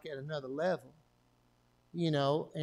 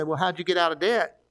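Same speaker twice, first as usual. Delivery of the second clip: louder, put-on voice of a questioning churchgoer; mock curious listener; 7 dB louder than usual. Pastor voicing an imagined listener asking how he got out of debt. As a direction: ham up a nosy congregant asking. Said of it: "You say".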